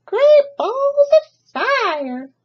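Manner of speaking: very high, very slow honking goose voice; goose crying out. Acted. Goose finds the nest, slow high honking exclamation. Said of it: "she cried".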